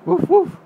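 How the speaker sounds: high loud playful woof; dog bark imitation. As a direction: bark like a puppy for a toddler.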